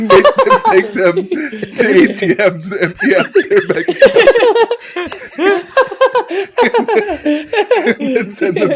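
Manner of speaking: words squeezed out through laughter; cracking up mid-sentence; helpless laughter while telling the story; laughing. Real, not acted.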